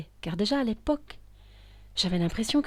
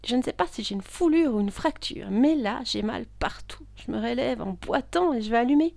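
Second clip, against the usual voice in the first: in pain; 5 semitones higher, 5 dB louder than usual. Acted. Hurt all over after the fall.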